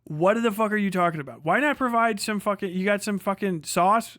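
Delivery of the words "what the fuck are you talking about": ordinary delivery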